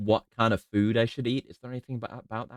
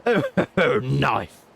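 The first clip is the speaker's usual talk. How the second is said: put-on slipping British accent drifting into something else; half-hearted British accent that falls apart; failed British accent. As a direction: try a posh accent and lose it. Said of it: "that's not a British accent anymore".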